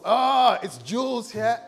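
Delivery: booming high hail, hammed up; club entrance. Trying a name on entering the club, booming mock hail. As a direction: burst in and announce yourself loudly. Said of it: "You enter the club".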